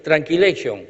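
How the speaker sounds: normal talking voice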